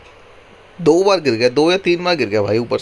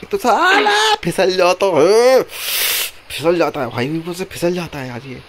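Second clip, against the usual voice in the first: much higher, louder, slow drawn-out complaint; exasperated whine; 4 semitones higher, 4 dB louder, slower than usual. Real, not acted. Complaining to his partner that the character keeps slipping, slow whine. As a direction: stretch the words out in despair.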